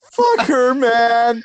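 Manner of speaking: high-pitched mock wail over a breakup; mock heartbreak. Acting out his own breakup meltdown, mock heartbroken. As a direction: whine it in hammed-up despair. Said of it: "I was just more of".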